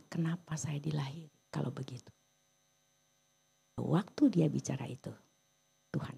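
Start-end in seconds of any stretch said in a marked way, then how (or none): 0.1-2.1 hushed voiceless whisper of Job's lament; despairing whisper. Retold or imitated. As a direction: breathe it out as a bitter question to God. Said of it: "Dia bilang".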